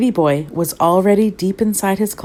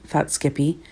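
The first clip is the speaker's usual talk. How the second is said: ordinary delivery, nothing marked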